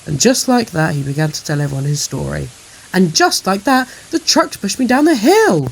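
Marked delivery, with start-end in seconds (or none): 2.9-5.7 high, loud little engine telling a tale; breathless storyteller train, loud and high; excited Percy. A narrator's reading. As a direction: recount it with high-pitched excitement. Percy excitedly tells his story.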